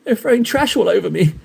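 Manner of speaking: a hint of fake self-pity, whiny and pleading; hammed-up victim. Streamer mocks Dark pleading for sympathy after the trash, hammed-up whine. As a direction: play the wounded victim, lightly hammed. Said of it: "He's like".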